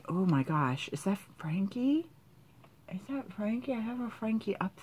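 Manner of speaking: breathless whisper of recognition; hushed whisper. Real, not acted. Spotting her favourite doll, she whispers in awe. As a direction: whisper it like a secret discovery.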